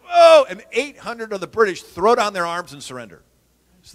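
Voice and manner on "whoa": loud, high startled yell; startled shout. Retold or imitated. British soldiers caught by a flank attack, startled loud yell. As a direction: cry out as if ambushed from the side. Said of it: "And they're like".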